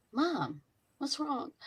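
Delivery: lightly voiced small girl asking, soft and slow; little daughter voice. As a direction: mom imitating her daughter's worried question. Mom retells daughter coming in.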